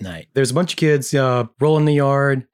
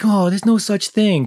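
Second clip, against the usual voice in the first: higher-pitched scoffing imitation; mock dismissive; 8 semitones higher, 3 dB louder than usual. Recapper quoting the boy denying witches exist, mock dismissive. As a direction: brush it off in a raised kid voice. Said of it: "so he's like".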